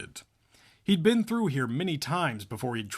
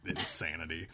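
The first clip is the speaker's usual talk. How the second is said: normal talking voice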